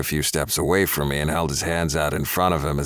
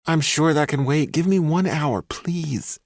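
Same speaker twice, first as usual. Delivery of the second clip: much higher, wheedling man's voice; 11 semitones higher, slower than usual. A narrator's reading. Ex boyfriend wheedling the woman he stalked. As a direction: sweet-talk her into lunch. Ex begs.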